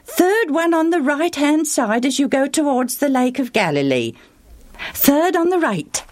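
put-on a cheerful boy helping a traveller; eager boy. Boy gives directions.

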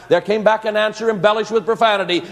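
ordinary talk, nothing marked

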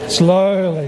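"slowly" stretched out each time; exaggeratedly slow. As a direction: draw out "slowly" to mimic overcautious driving. Acting out careful, slow driving.

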